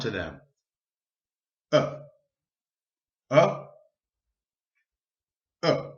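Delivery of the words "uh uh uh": short relaxed "Uh" with lips barely moving; schwa sound. Shown as a demonstration. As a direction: say "Uh" lazily like a sleepy answer. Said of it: "It's a very easy sound to say".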